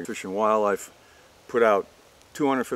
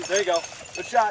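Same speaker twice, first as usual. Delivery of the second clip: high, raised cheer of praise; excited praise; 10 semitones higher than usual. Real, not acted. Praising a young hunter's shot.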